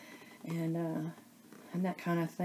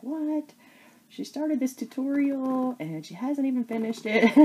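higher, teasing mock indignation; mock viewer complaint; 7 semitones higher, 5 dB louder than usual. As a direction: playfully voice an impatient viewer. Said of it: "I know y'all are probably like".

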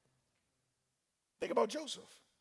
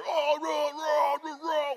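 high, sustained "raw" roars; mock worship roar; 8 semitones higher, slower than usual. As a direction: imitate a fired-up worship crowd roaring. Imitating fiery worship singing.

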